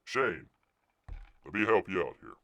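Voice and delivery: normal talking voice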